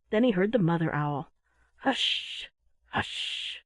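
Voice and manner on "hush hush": whispered, drawn-out shushing; hushing whisper. A narrator's reading. Mother owl to her owlets, hushing whisper. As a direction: whisper it to settle a child.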